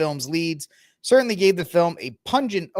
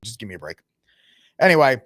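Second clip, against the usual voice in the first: ordinary delivery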